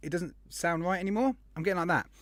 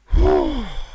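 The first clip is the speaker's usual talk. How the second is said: heavy sigh; 12 semitones higher, 10 dB louder than usual. Real, not acted. Host gasping early in stream.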